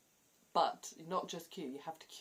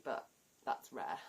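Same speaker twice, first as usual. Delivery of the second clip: ordinary talk, nothing marked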